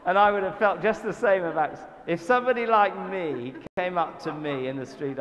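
talking loud through laughter; laughing. Real, not acted. Preacher laughing at his own southern accent.

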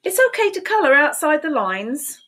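bright, high storybook read; sing-song read-aloud for little kids; reading to children. A narrator's reading. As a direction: read it like a cheerful picture book. Reading picture book aloud.

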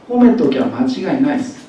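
ordinary talk

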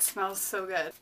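usual speaking voice

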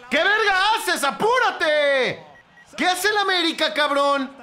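loud, high exasperated yelling; angry shouting. Real, not acted. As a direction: scream at the players to hurry up. Angry at team wasting time. (gritos furiosos de desesperación)

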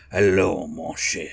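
put-on a wrong-sounding French accent as Pepe Le Pew; mock French accent. Imitating Pepe Le Pew to the co-hosts in a mock French accent. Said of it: "No, wrong accent".

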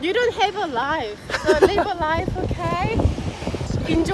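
slow loud telling-off; loud scolding. Real, not acted. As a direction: scold him loudly, slowly. Friend scolding loudly.

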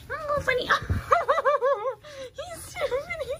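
high giggling through the words; laughing. Real, not acted. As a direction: laugh it out, can barely talk. Laughing at funny puppy.

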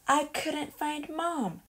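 ordinary delivery, nothing marked

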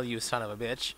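ordinary talk, nothing marked

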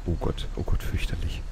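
hushed, nervous murmur; tense whisper. Real, not acted. Player hiding and working in the game, hushed dread. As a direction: breathe it out in dread. Tense while killer is near.

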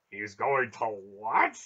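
loud disbelief, WHAT jumping up; incredulous. A narrator's reading. Barak hearing the plan to go through rock, incredulous. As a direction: blurt it out in astonishment.

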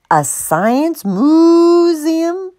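high moan with a long mooing MUUU in museum; slow, drawn-out bovine moan; moaning cow. A narrator's reading. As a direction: moo the word museum in dismay. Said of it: "Martha moaned".

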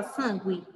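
"sangue" questioned with a sounded U, SAN-gwi; U pronunciado, errado. Shown as a demonstration. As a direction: say "sangue" wrongly with a GW. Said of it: "você não fala sangue". ("sangue" com o U pronunciado, sangüe)

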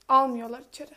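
usual speaking voice

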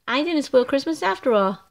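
plain reading voice

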